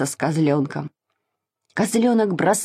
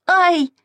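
high-pitched "ouch" cry; pained yelp; 11 semitones higher, 3 dB louder than usual. A narrator's reading. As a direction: yelp as if just butted. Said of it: "закричал Саша". (вскрик от боли, высоким голосом)

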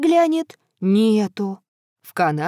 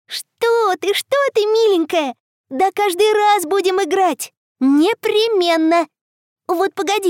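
put-on comforting little girl; 6 semitones higher, 3 dB louder than usual.

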